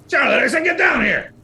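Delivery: high, loud bickering yell; insistent shout. Acted. Spider husband orders missus down, insistent high shout.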